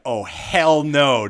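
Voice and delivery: slow, loud, high "hell no"; mock outrage. Players reacting to being hit with a spatula, mock outrage. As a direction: drag it out like a stunned player. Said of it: "And we're like".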